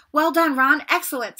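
put-on the self-important older brother approving; Percy congratulating Ron with pomp; pompous praise. A narrator's reading. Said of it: "said Percy Weasley pompously".

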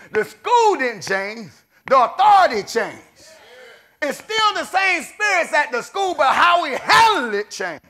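slow, loud, high preaching climax; preaching at full force. Acted. Preacher on his son's school, slow loud climactic preaching. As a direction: hammer each line out slowly.

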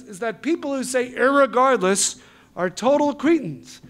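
high, loud mock wife; hammed-up indignant wife. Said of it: "she says".

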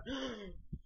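soft acted gasp on tearing up; mock tearful gasp. Man tearing up at seeing the woman, mock gasp. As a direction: gasp as if welling up. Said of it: "he's tearing up".

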